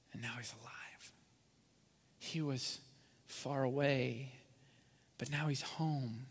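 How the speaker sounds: soft, hushed, tender joy; tender father. Retold or imitated. Father telling servants his lost son is home, soft and tender. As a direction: speak it gently, almost to himself.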